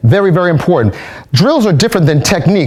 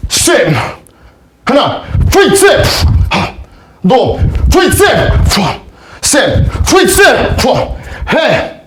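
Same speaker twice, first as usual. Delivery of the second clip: high, barked martial arts count, much higher; calling drill counts; 8 semitones higher, much slower than usual. Acted. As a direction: call each count sharp and high like a drill instructor. Instructor calls drill commands.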